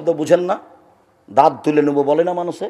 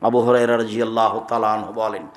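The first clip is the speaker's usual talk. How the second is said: normal talking voice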